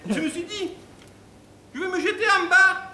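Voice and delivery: loud, high falsetto lament; melodramatic bimbo. Acted. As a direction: wail the tale as an over-dramatic bimbo. Bimbo ends her tragic tale. (lamentation aiguë de bimbo)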